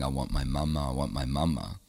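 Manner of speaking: soft, whimpering plea of a lost toddler, lightly imitated; half-cry it like a little boy wanting his mum; crying child. Lost three-year-old in traffic calling for mum, mild imitated sob. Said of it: "I'm crying".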